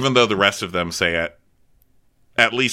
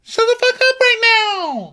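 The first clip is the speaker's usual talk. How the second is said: loud, piercing mad squeal, imitated; Brandy impression; 7 dB louder than usual. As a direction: squeal it in hammed-up fury. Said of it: "Brandy squeals. That's how she talks".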